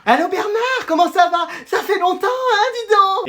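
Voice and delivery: very high, gushing sweet greeting; honeyed phone voice. Acted. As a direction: greet an old friend in sugary high singsong. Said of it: "t'as une voix toute mielleuse". (voix mielleuse très haut perchée)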